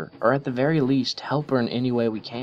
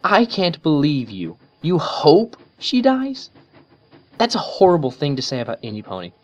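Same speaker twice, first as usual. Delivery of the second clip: angry Twilight; 6 semitones higher, 6 dB louder than usual. A narrator's reading. Twilight furiously rebukes Rainbow for wishing Trixie dead. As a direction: scold her friend hard. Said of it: "eyes flashed with anger".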